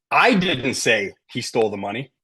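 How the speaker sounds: emphatic I, implying someone else said it; stress on I. Shown as a demonstration. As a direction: lean hard on the word I. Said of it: "if you emphasize certain words".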